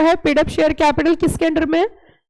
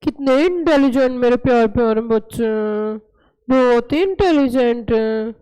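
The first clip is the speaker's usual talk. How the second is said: fond praise; 5 semitones lower than usual. Acted. Praising the children.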